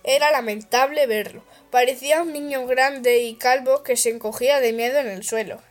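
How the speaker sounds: usual speaking voice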